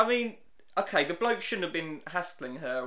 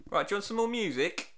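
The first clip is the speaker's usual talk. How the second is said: ordinary talk, nothing marked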